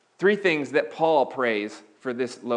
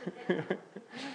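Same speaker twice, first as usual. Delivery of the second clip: self-conscious laugh; 6 semitones higher, 11 dB quieter than usual. Real, not acted. Laughs at own accent attempt.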